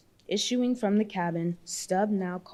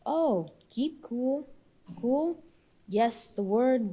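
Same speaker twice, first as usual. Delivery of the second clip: mocking; 5 semitones higher, slower than usual. Stubb mocks the angry mate.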